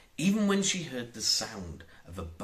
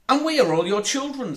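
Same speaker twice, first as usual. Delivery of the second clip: put-on eager lost boys; 12 semitones higher, 8 dB louder, faster than usual. Lost boys greeting Wendy.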